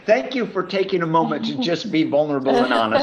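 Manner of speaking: amused mock-formal thanks; laughing thanks. Real, not acted. Laughing at couple's admitted bickering.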